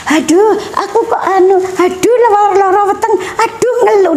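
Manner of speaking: high-pitched mock moaning; imitated complaining. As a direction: act out a mother griping about her aches, pitched high. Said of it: "kalau ada apa-apa mengeluhnya maju dulu". (menirukan keluhan dengan nada tinggi)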